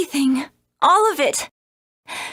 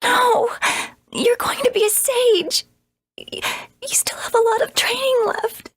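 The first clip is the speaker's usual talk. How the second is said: higher, tearful sobbing plea; crying plea; 6 semitones higher than usual. Acted. Lynn begs her dying master not to leave, crying. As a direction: beg him not to die through tears.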